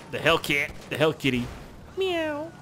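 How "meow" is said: high squeaky cat meow. Pretend. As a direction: mew like a cartoon kitty. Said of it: "it's a cat, get it".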